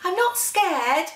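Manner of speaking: put-on high, slow nervous child; lightly voiced scared child. The Gruffalo's child to herself, a light nervous child voice. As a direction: say it trying to sound brave.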